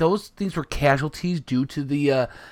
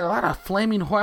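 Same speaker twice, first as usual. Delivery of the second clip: usual speaking voice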